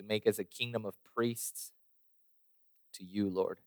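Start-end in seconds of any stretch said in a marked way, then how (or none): none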